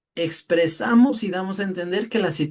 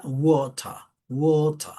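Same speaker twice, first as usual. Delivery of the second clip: British "water" with an open O, no R; British pronunciation; much slower than usual. Shown as a demonstration. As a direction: round "water" into British "WOH-tah". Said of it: "el sonido de una O abierta".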